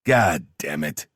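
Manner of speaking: slow, drawn-out curse; weary, slowed swearing after a rant; spent cursing. A narrator's reading. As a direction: curse with the steam burned out. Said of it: "having burned out the steam he was letting off".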